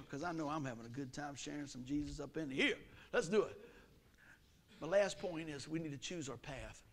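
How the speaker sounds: ordinary delivery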